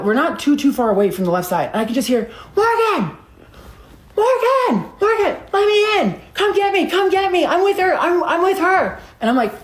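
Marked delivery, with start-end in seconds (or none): 2.6-9.0 strained, high hollering imitation; imitated screaming. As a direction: mimic someone desperately yelling to be let in. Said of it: "he's screaming".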